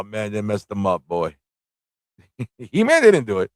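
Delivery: ordinary delivery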